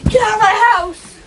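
loud playful yell; shouting. Pretend. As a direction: yell it as a mock owner. Playful mock eviction.

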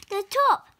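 The top called out high and loud; triumphant. A narrator's reading. Narrator calls out reaching the top, high and loud.

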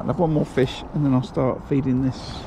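usual speaking voice

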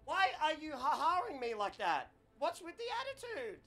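high-pitched, affronted complaint; miffed, high-voiced objection; indignant whine. Real, not acted. Objects to the AI's laughing.

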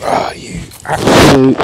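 real frustrated outburst, cut short before the swear; stopping a swear word halfway; bitten-off curse. Real, not acted. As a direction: catch yourself mid-curse, annoyed. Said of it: "nearly swore there".

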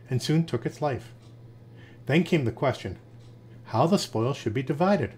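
ordinary delivery, nothing marked

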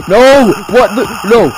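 high, loud, frantic pleading; real panic shouts; terrified yelling. Real, not acted. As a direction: scream it as if being chased. Chased in horror game.

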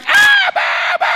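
very high, loud scream for daddy; child screaming. Acted. Tortured child screaming for his father in the next room, desperate. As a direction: cry out to your father in terror.

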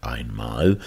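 ordinary delivery, nothing marked

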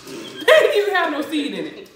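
laughing loudly in disbelief. Real, not acted. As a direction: laugh through the words. Laughing at empty seeds.

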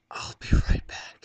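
breathy, forced, loud whisper; panicked loud whisper. Retold or imitated. Buyer short on money telling the clerk to wait, whispered. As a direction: blurt it out in a panic. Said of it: "I was like".

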